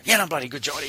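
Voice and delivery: pumped-up mock confidence before the race; imitating a cocky athlete. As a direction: bounce it out like she's on fire. Athlete retold as confident before final.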